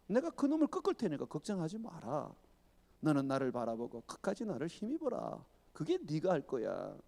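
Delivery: calm a frightened servant gently; God reassuring. Acted.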